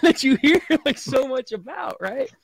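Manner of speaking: high, loud mock complaint; exaggerated disappointment. Tourist let down by a polite New York cop, loudly disappointed. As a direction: wail it like a cheated customer, hammed-up. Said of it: "this is a disappointment".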